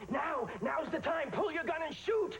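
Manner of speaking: high, urgent cry; high-pitched, panicky command; frantic urging. Acted. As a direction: urge them to act this second. Urging someone to shoot captors.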